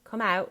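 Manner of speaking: "out" ending on the vowel; dropped final T. Shown as a demonstration. Coach repeating for the actor, dropped final T. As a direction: COME OUT with the t left off. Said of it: "no T".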